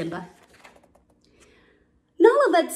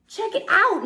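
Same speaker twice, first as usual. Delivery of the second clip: high, eager cub voice; excited exclamation; 12 semitones higher, 4 dB louder than usual. A narrator's reading. Cub splashing into the water, exclaiming. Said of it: "Nala exclaimed".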